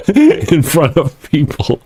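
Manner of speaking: laughing through the words, slowed. Real, not acted. Embarrassed about joking on air, laughing. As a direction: giggle the words out slowly. Laughing at his own joke.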